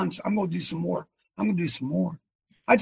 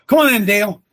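calling someone in; 3 semitones higher, 8 dB louder than usual. Real, not acted. Calling a man to join him.

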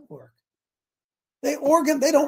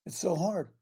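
quiet; 2 semitones lower, 7 dB quieter than usual. Real, not acted. Preacher contrasting after shout.

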